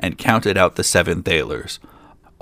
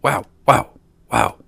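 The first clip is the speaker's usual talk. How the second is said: loud, higher-pitched bark; short, separate dog woofs made of words; 3 semitones higher, 4 dB louder than usual. A narrator's reading. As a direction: bark each word like a dog. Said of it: "and barked".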